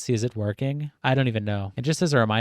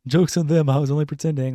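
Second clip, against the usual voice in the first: higher, louder smug meme quote; lightly voiced smug meme character; mocking meme voice; 4 semitones higher, 4 dB louder than usual. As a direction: sneer it like a smug comic.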